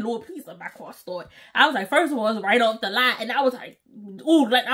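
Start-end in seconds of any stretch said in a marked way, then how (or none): 0.0-1.3 whispered-soft little prayer to the Lord; 1 hushed prayer. Retold or imitated. Hushed plea as she turns the key, almost silent. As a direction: breathe it out as a quick plea. Praying under breath while car starts.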